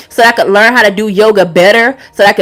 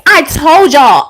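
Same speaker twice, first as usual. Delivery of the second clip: ordinary talk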